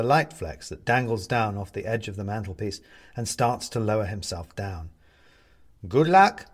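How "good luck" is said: high, loud call of good luck. A narrator's reading. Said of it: "calls out Bobs".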